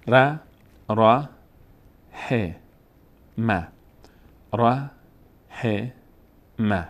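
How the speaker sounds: "ر، ه، م" as isolated syllables with long gaps; one by one. Shown as a demonstration. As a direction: spell out "ر، ه، م" syllable by syllable. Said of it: "حرفها رو یکی یکی بخوانید". (شمرده و جدا جدا)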